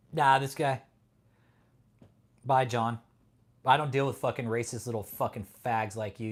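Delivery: ordinary talk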